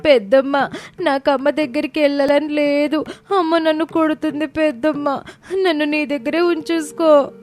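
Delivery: put-on high-pitched plaintive child voice; scared, crying child. A narrator's reading. Scared boy begs his aunt to keep him, tearful. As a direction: beg tearfully as a small child. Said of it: "భయంతో వాళ్ళిద్దరూ గట్టిగా ఏడవటం మొదలుపెట్టారు".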